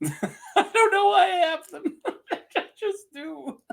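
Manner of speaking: high voice breaking up with laughter; real laughing. Real, not acted. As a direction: talk helplessly through giggles. Cracks up at own sound clips.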